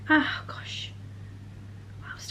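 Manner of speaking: whisper. Real, not acted. Muttered aside while filming.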